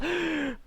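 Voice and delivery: sudden high-pitched gasp. Real, not acted. Streamer reacting to teasing about himself, high gasp. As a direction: draw a quick, high gasp. Reacting after conceding friend's jab.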